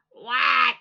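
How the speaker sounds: loud yelled what; shouting back. Acted. Froggy yelling back at mother, loud. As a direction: holler back from outside.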